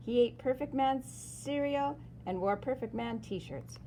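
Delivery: usual speaking voice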